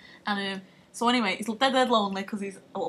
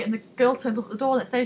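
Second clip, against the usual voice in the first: ordinary talk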